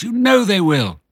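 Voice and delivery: high, tearful, pleading; sobbing. Acted. Missus Fox answering her husband, high tearful sob. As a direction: plead through mock sobs. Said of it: "sobbed Missus Fox".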